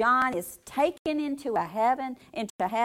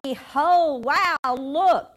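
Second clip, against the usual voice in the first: higher, louder burst of wonder; excited behold; 6 semitones higher, 9 dB louder, much slower than usual. Acted. Preacher voicing the elder to John, excited and loud. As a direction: cry out behold as if seeing it.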